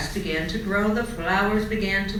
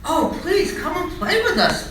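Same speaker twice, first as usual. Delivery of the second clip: high, laughing eager plea; begging; 10 semitones higher, 4 dB louder than usual. A narrator's reading. Monkeys beg Charlie.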